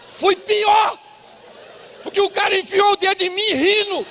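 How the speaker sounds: loud, high, driving preacher voice; revved-up preacher storytelling; full-force preaching. Acted. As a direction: belt it out to the congregation.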